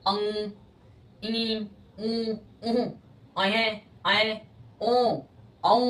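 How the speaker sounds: अंग, ई, ऊ नाक से गुनगुनाते हुए; हर स्वर नाक से आधा निकालकर बोलो; nasal vowel demo. Shown as a demonstration. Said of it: "उसका आधा हिस्सा नाक से भी निकलेगा". (अनुनासिक स्वर नाक से बोलना)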